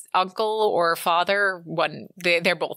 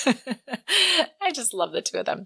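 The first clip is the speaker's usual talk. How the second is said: laughing fondly; 7 semitones higher, slower than usual. Real, not acted. Laughing about the couple.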